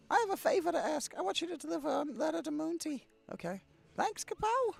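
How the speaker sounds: light, high game character voice. Acted. Streamer voicing a game character asking a favor, high put-on voice.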